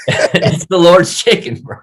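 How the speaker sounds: slow, high comic proclamation; silly exalting. Pretend. Podcast guest praising Chick-fil-A to his host, comically exalting. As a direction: stretch it out in a high put-on voice.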